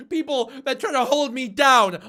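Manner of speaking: high, slow, loud whiny parody; mock dramatic victim. Streamer parodying her haters line, slow whiny mock voice. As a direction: ham up the self-pitying victim. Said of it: "And I was like".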